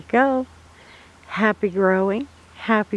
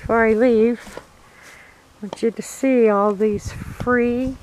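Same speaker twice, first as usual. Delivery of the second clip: ordinary talk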